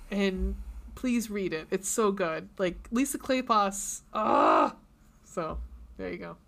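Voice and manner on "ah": loud, rough, low "ah"; swooning groan. Real, not acted. Fan gushing over Lisa Kleypas, swooning groan. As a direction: let out an overwhelmed fan's groan.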